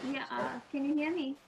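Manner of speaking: faint voice, far from the mic; barely audible. Real, not acted. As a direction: speak too far from the microphone. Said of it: "Your, your voice is very faint".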